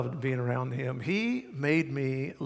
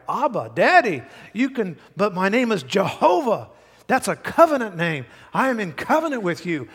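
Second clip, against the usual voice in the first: loud, higher preaching voice; preaching at full force; 5 semitones higher, 7 dB louder than usual. Real, not acted. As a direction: drive the promise home, full force.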